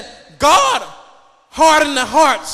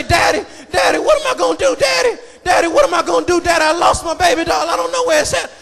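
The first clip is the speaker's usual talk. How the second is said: imitated little daughter; faster than usual. Daughter to daddy over a lost baby doll, imitated fretting. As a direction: mimic a fretting child, pitch leaping up. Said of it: "It reminds me of how my daughter".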